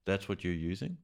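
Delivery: ordinary talk, nothing marked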